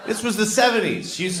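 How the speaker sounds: ordinary talk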